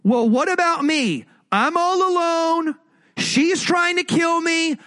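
high, slow, wailing complaint; whiny pity party. Retold or imitated. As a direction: wail it like everything is lost. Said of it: "Everything he's saying is this".

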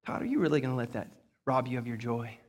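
low, very soft, quick murmur; hushed voice in the back of the head; little inner voice. Acted. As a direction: play a quiet conscience nudging from within. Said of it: "I hear this little voice".

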